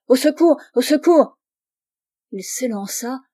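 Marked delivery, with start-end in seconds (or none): 0.1-1.4 higher, louder alarmed call; cry for help. A narrator's reading. Father finds everyone dying and calls for help, alarmed cry. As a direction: call out urgently for rescue.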